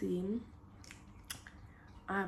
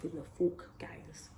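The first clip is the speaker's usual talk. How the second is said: whispering; 2 semitones lower, 9 dB quieter, slower than usual. Real, not acted.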